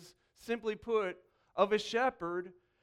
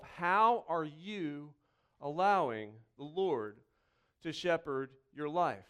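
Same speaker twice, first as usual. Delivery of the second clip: "How are you allowing the Lord to shepherd your life?" slowed right down and lower; slow, probing repeat; 4 semitones lower, slower than usual. Shown as a demonstration. Pastor asks the congregation again, slowly and gently. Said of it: "It's a simple question again".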